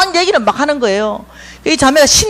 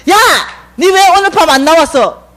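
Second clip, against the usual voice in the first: calling out; 6 semitones higher, 3 dB louder than usual. Retold or imitated. Friend calls her on street.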